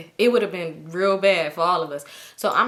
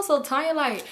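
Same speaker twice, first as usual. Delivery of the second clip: normal talking voice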